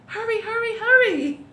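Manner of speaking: high, loud stage-whisper urging; insistent storybook pelican; urgent hurrying. A narrator's reading. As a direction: press them on in a high voice. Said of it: "whispered the pelican".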